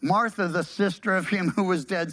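ordinary talk

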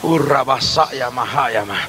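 slow murmured tongues; speaking in tongues. Real, not acted. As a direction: pray in tongues, slow and low. Glosolalia en culto. (hablar en lenguas, lento y bajo)

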